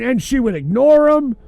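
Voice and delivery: ordinary delivery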